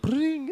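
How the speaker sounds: high tinkling ping; ping like water turning to liquor; mouth-made sparkle noise; magic chime sound. Retold or imitated. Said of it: "this little sound".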